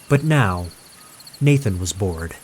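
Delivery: ordinary delivery, nothing marked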